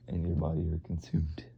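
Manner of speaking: breathy dropped whisper. Acted. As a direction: let it die into a whisper. Dramatic reading fading to whisper.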